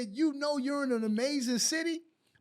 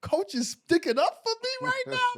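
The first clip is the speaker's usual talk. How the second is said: much higher, astonished squeak of surprise; amazed disbelief; 10 semitones higher than usual. Retold or imitated. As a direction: retell your stunned amazement. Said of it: "But I was like".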